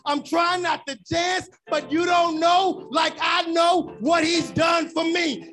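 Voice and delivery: rising shouted sermon cadence; full-force preaching. Acted. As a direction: preach it out to the whole church. Call-and-response praise, shouting.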